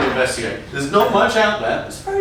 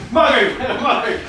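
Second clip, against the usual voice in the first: normal talking voice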